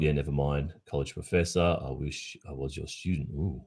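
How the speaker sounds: ordinary talk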